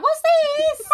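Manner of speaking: put-on high, loud curious child; excited kid. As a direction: shout it out like a thrilled kid. Kids finding the dildo.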